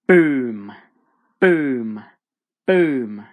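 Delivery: loud, falling booms; heavy, emphatic sound-effect word; booming footsteps. A narrator's reading. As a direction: land each boom like a giant's footfall. Giant's footsteps shake the room.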